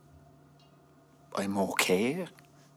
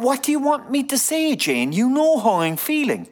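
much higher and louder, cracking frustration; exasperated outburst; 10 dB louder than usual. Acted. Husband snapping at wife who keeps asking, loud. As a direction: snap back, pushed past the limit. Husband exploding under wife's pressing.

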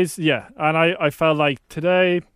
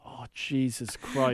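exasperated mutter; 5 semitones lower, 9 dB quieter, slower than usual. Retold or imitated. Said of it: "And I'm like".

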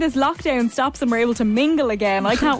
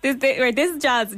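usual speaking voice

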